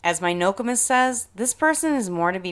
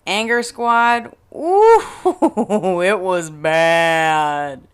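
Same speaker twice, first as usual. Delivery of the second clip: slow, loud build into a laugh; dramatic reveal; 6 dB louder, much slower than usual. Real, not acted. Telling listeners the photo was bad, with a slow laughing reveal. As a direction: hold it, laugh, then land it slowly.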